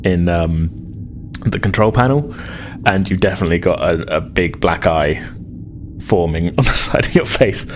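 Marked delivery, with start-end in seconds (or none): none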